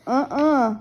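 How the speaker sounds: high refusal through a mouthful; muffled little-sister no; mouth full. A narrator's reading. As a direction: refuse with teeth clamped on something. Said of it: "she said through a mouthful".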